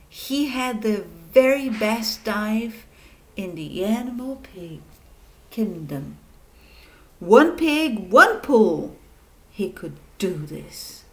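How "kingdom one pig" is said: plain reading voice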